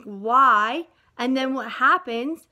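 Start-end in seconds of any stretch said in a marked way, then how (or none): 0.0-0.9 loud cry of why. Acted. Frog prince cries out his question.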